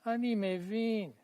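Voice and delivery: high model line; modelled line. Shown as a demonstration. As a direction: lift the voice to model the dialogue line. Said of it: "Try it".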